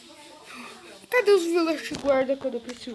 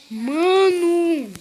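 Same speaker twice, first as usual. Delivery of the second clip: startled shout; 5 semitones higher, 10 dB louder than usual. Real, not acted. Sudden fright in game.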